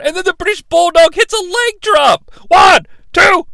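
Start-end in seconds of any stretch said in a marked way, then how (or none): 2.5-3.5 high shouted pin count; hammed-up referee-count shout; excited count. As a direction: call the count like a live announcer.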